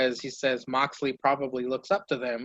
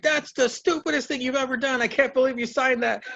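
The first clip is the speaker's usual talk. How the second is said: high, sneering ridicule; high-pitched taunting laugh-voice; mocking heel; 10 semitones higher than usual. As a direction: jeer at him in a high voice.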